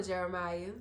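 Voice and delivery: ordinary talk, nothing marked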